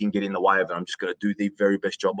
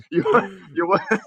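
higher-pitched, words broken by laughter; laughing through words; 9 semitones higher than usual. Real, not acted. Guest joking to host about being looked upon, laughing. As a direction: crack up trying to get the words out. Breaks up laughing mid-joke.

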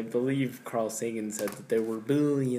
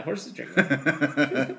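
laughing; 2 semitones higher, much slower than usual. Real, not acted. Joking about horse milk.

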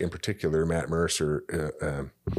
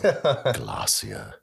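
higher, drawn-out British narrator voice; British accent; 6 semitones higher than usual. Acted. Relishing a favourite narrator word, British accent. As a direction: savour it slowly in a British accent. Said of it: "I just love that word".

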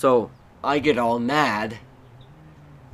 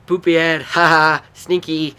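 loud mocking taunt; 5 semitones higher, 9 dB louder than usual. Little kid teasing his brother, loud and mocking. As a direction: jeer at him like a bratty kid.

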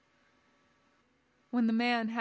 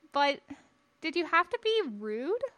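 put-on higher, boyish questioning; protesting young Padawan; 6 semitones higher than usual. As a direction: question your master reproachfully. Boy timidly challenges his master.

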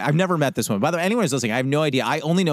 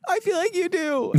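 teasing reply; 3 dB quieter than usual. Pretend. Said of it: "And I'm like".

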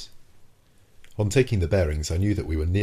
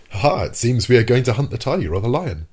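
louder, higher, jaunty amused bravado; mock carefree laugh; 6 semitones higher, 7 dB louder than usual. Nemo mimicking a hunter shrugging off tigers, loud and jaunty. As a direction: laugh it off like it's a lark.

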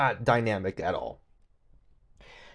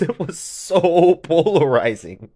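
emphatic; 7 semitones higher, 10 dB louder, slower than usual. Retold or imitated. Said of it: "she goes".

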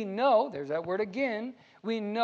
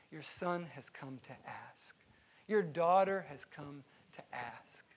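humble prayer; 6 semitones lower, 5 dB quieter than usual. Pretend. Child humbly approaching God in prayer.

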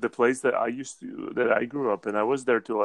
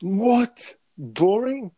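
high, outraged what; indignant disbelief; 10 semitones higher, 5 dB louder, much slower than usual. Real, not acted. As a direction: sound shocked and offended. Indignant at lasagna criticism.

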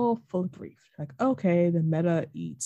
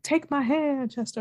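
higher retold plea; lightly voiced Meta; 8 semitones higher than usual. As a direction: beckon him in a slightly put-on voice. Acting the Meta luring Chester.

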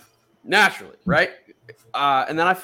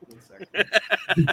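mock overwhelmed; 5 semitones higher, much slower than usual. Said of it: "I need a minute".

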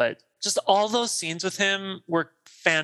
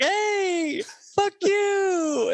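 gleeful shout; much slower than usual. Retold or imitated. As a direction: yell it out the window in triumph. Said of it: "He's like".

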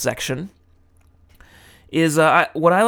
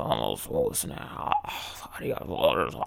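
much lower, softer mumble, words half swallowed; mumbled; 9 semitones lower, 10 dB quieter than usual. Shown as a demonstration. Tutor shows learners how to fake Japanese, mumbling softly. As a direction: mumble it under your breath like you half know it. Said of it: "Sort of mumble through it".

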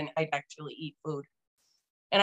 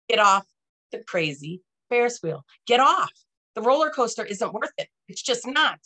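louder, higher punches on the commands; urgent plea; 5 semitones higher, 4 dB louder than usual. Retold or imitated. Inner voice urging the speaker off the pills, urgent plea. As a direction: urge firmly, in emphatic short bursts.

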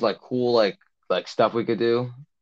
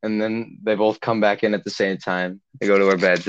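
ordinary delivery

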